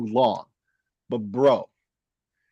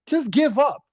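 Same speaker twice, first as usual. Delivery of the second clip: exasperated high; 9 semitones higher than usual. Acted. Louder higher repeat.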